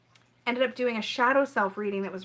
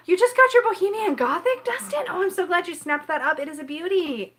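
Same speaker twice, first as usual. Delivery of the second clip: excited gasp; 7 semitones higher, 4 dB louder than usual. Real, not acted. Reacting to viewer's new deck.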